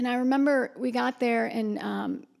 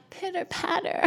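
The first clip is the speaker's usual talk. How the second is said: higher, a light put-on flutter; lightly voiced swoon on reading his sticker; mock swooning; 7 semitones higher, 3 dB quieter than usual. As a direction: playfully act out her heart fluttering. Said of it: "And I was like".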